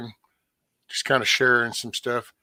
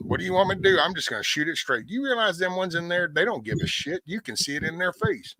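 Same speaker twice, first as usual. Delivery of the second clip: insistent retort; 8 semitones higher than usual. Retold or imitated. Retold heated reply to mom.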